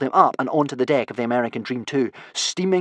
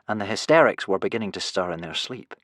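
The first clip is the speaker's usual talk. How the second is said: ordinary delivery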